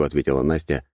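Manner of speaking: ordinary delivery, nothing marked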